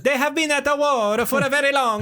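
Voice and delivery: high, hammed-up Italian declaiming; over-the-top mock-Italian accent; super Italian accent. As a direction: recite Shakespeare like a comic Italian. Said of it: "Just super Italian".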